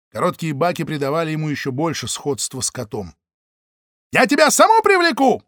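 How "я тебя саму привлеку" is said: very high, loud choking threat; spluttering rage. Acted. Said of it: "захлёбывался Г П Ушник". (захлёбываясь от ярости)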